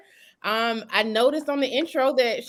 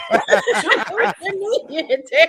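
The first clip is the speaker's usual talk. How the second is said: laughing; 3 semitones higher, 3 dB louder than usual. Real, not acted. Laughing while asking.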